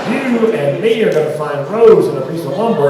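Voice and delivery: loud punchline. Retold or imitated. Comedian warns the old man the ship is sinking, loud. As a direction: hammer the doom warning home.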